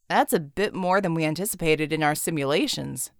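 lightly voiced calm analyst; genie voice. Genie joining the team, mild dry remark.

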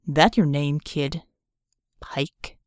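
low, cool voice; cold gang operative. Acted. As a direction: size him up coldly.

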